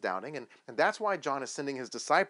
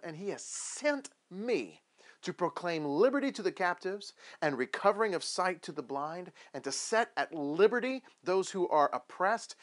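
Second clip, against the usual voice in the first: solemn scripture reading; 3 semitones higher than usual. Acted. Jesus reading Isaiah aloud in the synagogue, solemn and proclaiming. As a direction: proclaim it grandly, drawing out the words. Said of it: "the way I think he read it".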